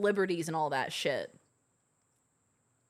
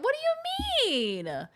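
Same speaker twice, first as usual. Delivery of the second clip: much higher, slow mock outrage; mock indignant; slower than usual. Real, not acted. Friend defending the accent claim, mock outraged. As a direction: squeal it in fake disbelief.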